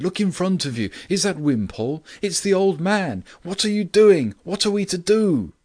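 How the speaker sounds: high, loud, exasperated questions, building to a shout; frantic cry. A narrator's reading. Bewildered narrator to Grant in mid-chase, frantic cry. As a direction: cry out at your wits' end. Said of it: "I cried".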